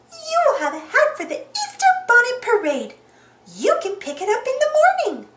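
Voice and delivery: high sweet cartoon mouse voice; bright, high and chirpy; Minnie voice. A narrator's reading.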